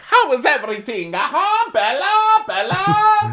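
loud, high, sung Italian waiter welcome; booming sing song welcome. Retold or imitated. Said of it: "the waiter's like".

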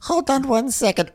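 a quick shrill-free high squeak of a pause; mock squeaky aside. A friend stalling before throwing up, high mock voice.

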